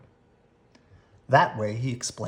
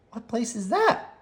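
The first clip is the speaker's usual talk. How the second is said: Sister voice; 10 semitones higher than usual. A narrator's reading. Sister asking Brother.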